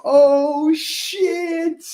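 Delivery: high, drawn-out "Oh, shit"; mock shock. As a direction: gasp it like caught off guard. Imagining the nickname.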